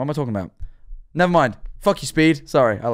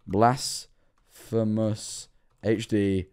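drawn-out name; 5 semitones lower, 7 dB quieter, much slower than usual. Acted. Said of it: "drawn-out name".

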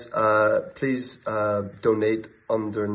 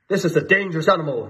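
higher, louder gruff warning; roaring chief; 6 semitones higher, 5 dB louder, faster than usual. A narrator's reading. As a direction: roar the warning. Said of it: "roared the chief".

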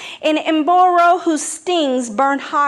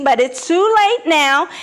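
higher, louder gloating; 7 semitones higher, 6 dB louder than usual. Acted. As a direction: rub in the victory. Anansi gloats.

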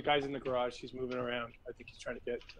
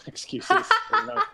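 laughing; 6 dB louder, slower than usual. Real, not acted. Laughs while teasing.

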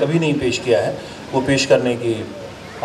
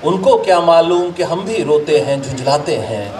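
measured, declaimed Urdu couplets with pauses; ghazal recitation; 4 semitones higher than usual. Acted. Poet presenting a ghazal to an appreciative gathering, declaimed recitation. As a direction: recite verse to a mushaira audience, line by line.

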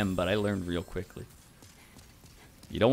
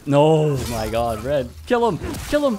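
frantic loud yell, higher pitch; shouting orders over battle; 6 semitones higher, 8 dB louder than usual. Real, not acted. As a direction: urge the teammate to finish him. Yelling at teammate during fight.

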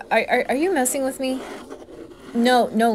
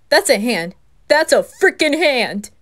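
louder, rising into a loud shocked cry; shocked, getting louder; alarmed outburst; 4 semitones higher, 8 dB louder than usual. Acted. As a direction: build from alarm to a loud yell. Sees a hand appear.

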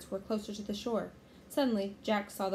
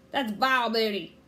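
loud pirate captain's voice; scornful pirate outburst; disgusted pirate; 8 dB louder than usual. A narrator's reading. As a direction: bellow your contempt for the loot.

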